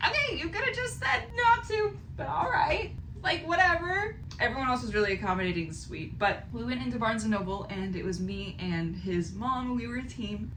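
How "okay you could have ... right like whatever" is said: high, louder, half-laughing sarcastic whatever; mock huffy retort. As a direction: brush them off with a squeaky sulk. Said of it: "I was like".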